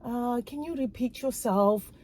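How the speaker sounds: soft, careful request to repeat; polite request. Shown as a demonstration. Listener kindly asking someone with an accent to repeat, polite. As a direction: ask gently, keeping the volume down. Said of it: "It's the way you represent it".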